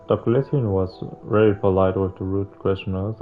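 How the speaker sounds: ordinary delivery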